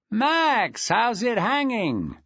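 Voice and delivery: put-on a deputy head greeting an old mate; Aaron Felton, jovial. A narrator's reading. Aaron Felton greeting Maxwell on the phone, jovial.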